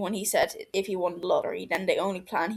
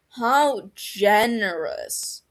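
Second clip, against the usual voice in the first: slow dry drawl; sarcastic drawl; 5 dB louder, much slower than usual. Acted. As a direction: stretch it out sarcastically. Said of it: "Barty drawls".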